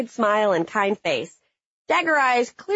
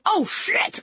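startled yell; 9 semitones higher than usual. Retold or imitated. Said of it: "Can't be sure if I yelled".

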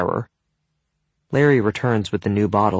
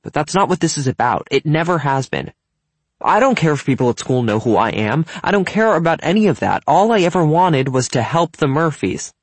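lightly acted, raised and insistent; earnest protesting teenager; 7 semitones higher, 5 dB louder than usual. A narrator's reading. Evan insisting to his friend it was never about popularity, earnest. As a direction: protest it with rising heat.

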